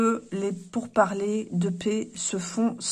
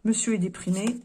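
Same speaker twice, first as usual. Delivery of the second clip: usual speaking voice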